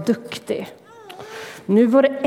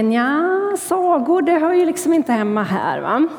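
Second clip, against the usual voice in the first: skeptical listener; 5 semitones higher than usual. Retold or imitated. Skeptical churchgoer doubting stories in church, high and dubious. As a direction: sound unconvinced that fairy tales belong here. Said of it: "en del kanske sitter och tänker".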